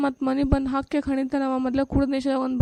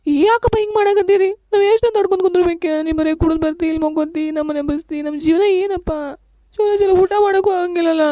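put-on wife lashes out at the drunk; angry outburst; 4 semitones higher than usual. Wife confronts drunk husband. (ಗಂಡನ ಮೇಲೆ ಸಿಟ್ಟಿನ ಕೂಗು)

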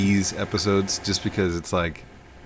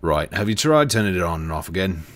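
lightly put-on British-Australian help desk voice; put-on British-Australian accent; 2 semitones lower, 4 dB louder than usual. Shown as a demonstration. Showing a friend the work phone act, put-on British-Australian accent. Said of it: "I got that one down pretty good".

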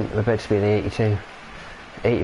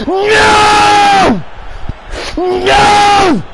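high, loud, desperate scream of no; anguished shout; 11 dB louder, much slower than usual. Real, not acted. Streamer screaming at the conceded goal, real anguished high shout. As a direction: yell it like your team just got scored on.

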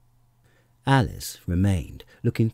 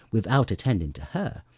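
plain reading voice

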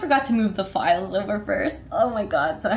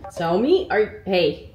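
normal talking voice

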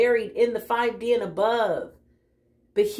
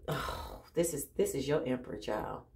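hushed; 6 semitones lower, 8 dB quieter than usual. Real, not acted. Hushed awe before card.

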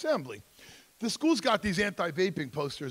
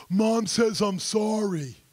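loud, slow, high sing-song sorry; fake apology; 6 semitones higher, 8 dB louder, much slower than usual. Kid forced by parents to apologize to a sibling, insincere. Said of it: "a fake apology".

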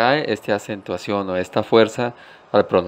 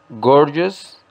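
gorgeous higher, weight on gor; stress on first syllable; 4 semitones higher than usual. Shown as a demonstration. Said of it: "Para su pronunciación". (fuerza en gor de gorgeous, más agudo)

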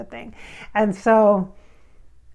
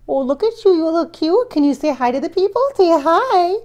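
higher and louder sing-song pet talk; 6 semitones higher, 5 dB louder than usual. Real, not acted. To Toby, asking him to greet viewers, baby talk.

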